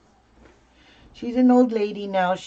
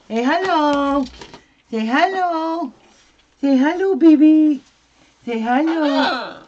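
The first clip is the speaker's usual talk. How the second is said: coaxing pet talk; 5 semitones higher, 6 dB louder than usual. Real, not acted.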